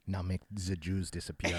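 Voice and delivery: put-on low, slow, hushed German accent; mock conspiratorial whisper. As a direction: murmur it darkly like a German confiding. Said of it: "he just whispers in your ear".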